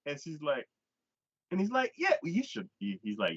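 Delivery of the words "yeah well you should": high-pitched mock retort; imitated insistence. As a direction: play a pushy date insisting. Voices the suitor insisting.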